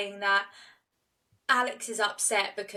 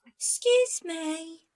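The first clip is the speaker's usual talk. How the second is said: much higher and very slow, tiny timid voice; meek excuse me; 9 semitones higher, much slower than usual. Retold or imitated. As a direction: stretch "Excuse me" out in a small innocent voice. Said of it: "like that".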